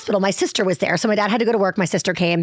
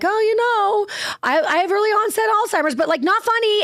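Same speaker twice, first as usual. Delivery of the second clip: higher, breezy mom voice; higher-pitched imitation of a carefree mother; imitating mom; 10 semitones higher than usual. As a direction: play the mom brushing it off lightly.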